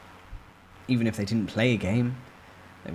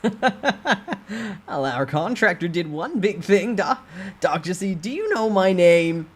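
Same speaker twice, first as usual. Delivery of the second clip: smug mocking boss; 10 semitones higher, 6 dB louder, slower than usual. Voices smug boss Han Mung-yo.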